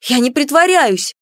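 high indignant outburst; indignant cry. A narrator's reading. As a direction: protest hotly, voice jumping up. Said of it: "возмущённо воскликнула".

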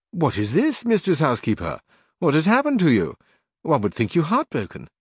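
a hint of a surprised scholar asking what's wrong; Samson Carrasco. A narrator's reading.

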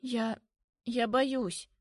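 falter, then admit the fear; timid, halting confession; frightened admission. A narrator's reading. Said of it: "Слышу её прерывистое дыхание".

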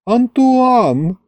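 put-on high sighing girl voice; sighed. A narrator's reading. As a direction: sigh the name dreamily. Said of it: "вздохнула Маша".

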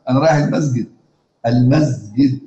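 المسجد with the s voiced like a z, "mezgid"; ishmam in colloquial. Shown as a demonstration. Teacher shows students the common folk "mezgid" with s voiced toward z. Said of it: "شوف بيقولها بالإشمام أهو".

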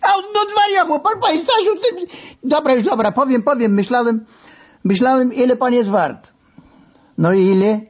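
very high, fast pained squeal; high squealing protest. A narrator's reading. Narrator squeals at the masseur pressing his sides. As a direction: squeal like you're being squeezed.

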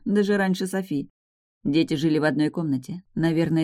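plain reading voice

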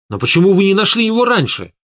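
high, quick burst of a question; exclaiming. A narrator's reading. Narrator challenging his boss about the lost son, exclaiming. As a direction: cry out the question, pitch climbing steeply. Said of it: "воскликнул я".

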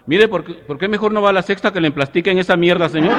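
high, loud fed-up retort; exasperated shopgirl. Acted. Comedian voicing the fed-up pharmacy girl telling off the customer, high and loud. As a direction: snap back at him, high and loud. Shop girl snaps in joke.

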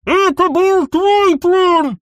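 high, loud, slow choked roar; muffled bark. A narrator's reading. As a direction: bark it out through a tight throat. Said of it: "рявкнул сдавленный голос". (сдавленный рык)

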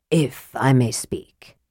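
low, slow, damaged voice; soft, damaged voice. A narrator's reading. Mage addressing the noisy assembly, soft damaged voice. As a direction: speak softly and low, as if the voice is damaged. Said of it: "in his soft, damaged voice".

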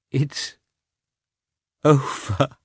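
high, halting, exhausted relief; faltering, tender, happy. A narrator's reading. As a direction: let it out haltingly, tenderly, with a long break between words. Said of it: "faltering, living, tender, happy".

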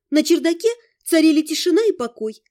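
ordinary delivery, nothing marked

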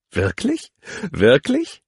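giddy squealing wirklich; playful teasing. Acted. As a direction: tease him, voice leaping up. Teasing while knocking him over.

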